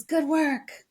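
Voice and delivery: high-pitched hearty approval; retold cheer. Guy giving a thumbs up to the volunteer, cheering. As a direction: call out praise like a passing stranger. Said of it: "and he says".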